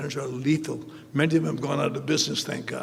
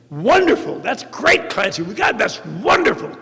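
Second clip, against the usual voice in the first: high, loud, over-the-top delight; mock-enthusiastic bosses raving over his write-up; gushing praise; 7 semitones higher, 8 dB louder than usual. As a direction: gush like an impressed boss.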